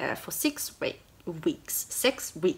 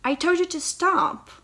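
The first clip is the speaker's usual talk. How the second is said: indignant heroine; 8 semitones higher, 4 dB louder than usual. Acted. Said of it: "she goes".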